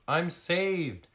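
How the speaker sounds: high, loud, relieved shout; shout of relief. Acted. Lost seal seeing the friends arrive, relieved shout. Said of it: "the seal shouted".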